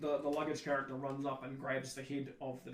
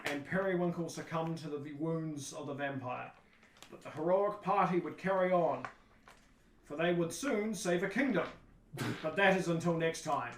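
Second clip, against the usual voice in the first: louder, grand, measured storytelling voice; stage narrator; 3 semitones higher, 6 dB louder than usual. Acted. Narrator closes the play as lights go down, grand narration. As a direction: declaim it like a melodramatic narrator. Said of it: "you hear this narrator go".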